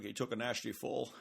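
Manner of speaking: hushed, lightly put-on movie-spy voice; a soft, understated hint of an impression; Sean Connery. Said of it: "Sean Connery would probably be more like".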